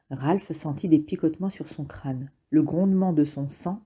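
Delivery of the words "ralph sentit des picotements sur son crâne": usual speaking voice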